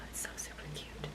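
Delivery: softly whispered gush. Real, not acted. Gushing to viewers about her ring, whispered. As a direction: breathe it out as a hushed secret.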